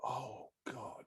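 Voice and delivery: unvoiced dismayed whisper; whispered oh God. Teacher losing hope in front of class, whispered. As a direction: breathe it out in quiet dread. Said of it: "And I'm like".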